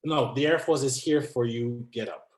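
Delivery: usual speaking voice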